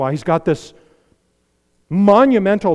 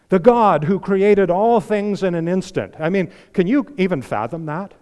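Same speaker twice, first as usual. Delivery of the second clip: ordinary talk, nothing marked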